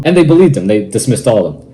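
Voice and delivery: ordinary talk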